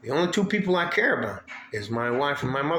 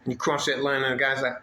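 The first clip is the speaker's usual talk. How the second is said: ordinary talk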